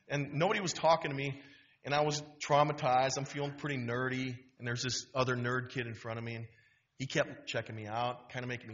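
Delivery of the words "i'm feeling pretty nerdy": ordinary delivery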